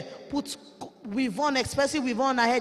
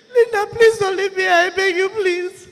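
loud, tearful, higher begging; crying plea; 4 semitones higher, 7 dB louder than usual. Retold or imitated. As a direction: beg through tears, louder. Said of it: "he said".

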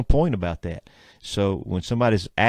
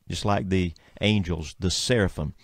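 ordinary talk

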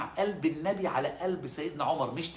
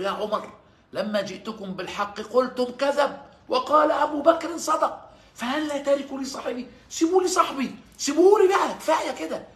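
much higher and louder, pleading and slow at first; impassioned outburst; 11 semitones higher, 9 dB louder than usual. Retold or imitated. The Prophet rebuking the companions, loud impassioned outburst. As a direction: cry out to defend a friend, rising to a peak. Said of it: "النبي انفعل قوي".

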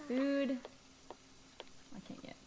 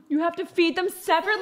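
shocked; 4 semitones higher, 9 dB louder, faster than usual. Real, not acted. Shocked at game mechanic.

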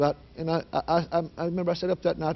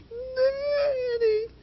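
tiny, very high imitated wail; squeaky scared voice. Terrified daughter trying to call her father, little squeaky voice. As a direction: barely squeeze the voice out. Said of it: "little squeaky voice".

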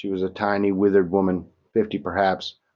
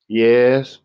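reluctant admission; 5 semitones higher, 8 dB louder than usual. A narrator's reading. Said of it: "she admitted slowly, reluctantly".

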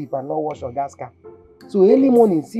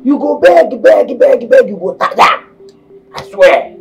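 mock her begging loudly; shouted gloating; loud taunt; 8 dB louder, slower than usual.

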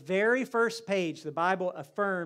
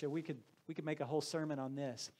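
ordinary talk

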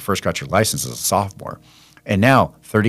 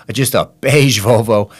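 laughing; 2 semitones higher, 4 dB louder than usual. Real, not acted. Mocking the dull family car.

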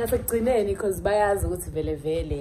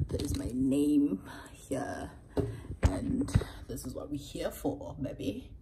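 reverent whisper; 5 semitones lower, 6 dB quieter than usual. Real, not acted. Creator showing her name card, a hushed whisper. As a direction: hush it like a secret.

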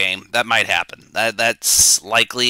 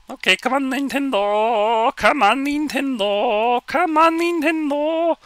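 high, drawn-out whiny falsetto; falsetto pleading; slower than usual. Pretend. Streamer begging Nintendo to start, slow falsetto pleading. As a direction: stretch each plea out in a squeaky high voice.

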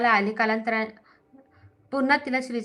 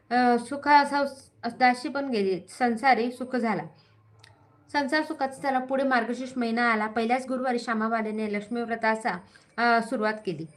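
ordinary delivery, nothing marked

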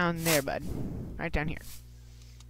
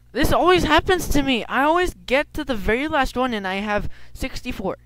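exasperated; 9 semitones higher, 8 dB louder than usual. Real, not acted. Frustrated at inventory full.

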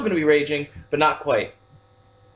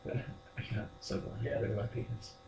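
unvoiced mock whisper; 4 semitones lower, 14 dB quieter than usual. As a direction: breathe it out silently. Mocking prank in whisper.

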